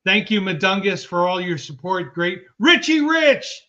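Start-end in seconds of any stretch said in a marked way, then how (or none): 2.6-3.6 high, loud, stretched-out name call. Real, not acted. Host hails a viewer in the chat, calling out. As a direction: announce the name slowly and big.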